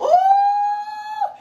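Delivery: sudden high falsetto ooh; high whoop. Real, not acted. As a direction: let out a joyful high whoop. Preacher exclaims mid-scripture.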